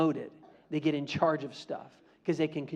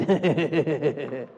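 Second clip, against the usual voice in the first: evil inner laugh; 5 semitones lower, 5 dB louder than usual. Retold or imitated. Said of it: "inside he's going".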